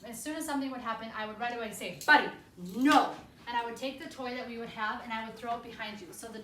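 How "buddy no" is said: sharp, loud, slow command to the dog; firm loud correction. Shown as a demonstration. Trainer correcting a mouthy dog, loud and firm. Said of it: "I would totally change my".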